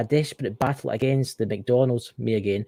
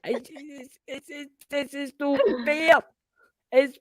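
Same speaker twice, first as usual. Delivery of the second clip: mock whine; 13 semitones higher than usual. Mock complaint about the flag.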